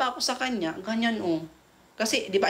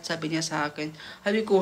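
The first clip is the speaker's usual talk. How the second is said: ordinary talk, nothing marked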